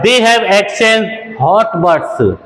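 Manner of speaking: very slow, careful teacher read; very slow example for learners; very slow model sentence. Shown as a demonstration. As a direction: dictate word by word. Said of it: "ये अच्छा है".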